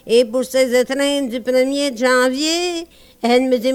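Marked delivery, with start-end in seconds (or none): none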